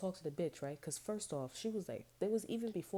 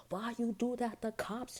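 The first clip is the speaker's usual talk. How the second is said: high, raised mock yell; higher, louder imitation of an angry caller; imitated phone yelling; 7 semitones higher, 4 dB louder, slower than usual. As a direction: mimic her yelling, pitched well up. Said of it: "She yelling on the phone with her mans".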